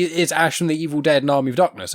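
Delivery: usual speaking voice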